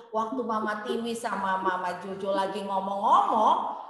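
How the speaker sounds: ordinary talk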